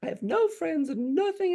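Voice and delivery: high-pitched imitated kid venting; upset daughter's voice. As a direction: pour it out like a wounded child. Continues voicing upset daughter.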